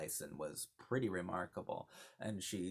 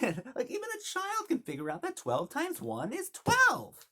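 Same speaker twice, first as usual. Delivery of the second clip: much higher, louder snappy retort; high squeaky quote; 6 dB louder than usual. Retold or imitated. Quoting the film's banter.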